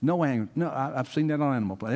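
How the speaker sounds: ordinary delivery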